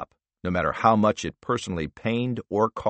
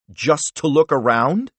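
scoffing disbelief; 6 semitones higher, 5 dB louder than usual. Acted. Senator scoffs at answer.

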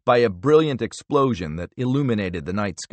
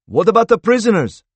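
loud, high, alarmed question; alarmed shout; 10 semitones higher, 8 dB louder than usual. A narrator's reading. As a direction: shout it over the chaos. Panicked question in ambush.

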